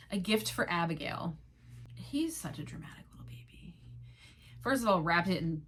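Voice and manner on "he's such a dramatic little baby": a hushed, teasing whisper under the breath; whispered aside. Real, not acted. Whispering a catty aside to viewers about Will. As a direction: lean in and whisper the gossip.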